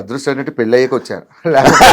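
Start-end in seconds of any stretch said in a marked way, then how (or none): 1.5-1.9 laughing. Real, not acted. Joking about staying a bachelor.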